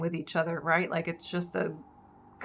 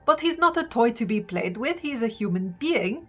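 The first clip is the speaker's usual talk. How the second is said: higher, emphatic character speech; impassioned plea; 4 semitones higher, 4 dB louder than usual. Acted. Seti pleading with the gods for Orin, impassioned. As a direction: press the case before a crowd. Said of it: "she's just like".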